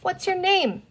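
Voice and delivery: put-on high, curious child's question. A narrator's reading. Boy asks the green creature its name, curious. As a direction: ask with open curiosity. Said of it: "Peter asked curiously".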